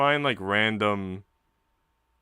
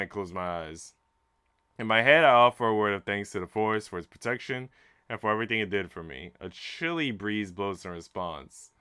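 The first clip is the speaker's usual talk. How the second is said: dramatic storytelling read over the music; story narration. A narrator's reading. Streamer reads the game's story aloud to chat, storytelling voice. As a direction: read it like a novel's climax.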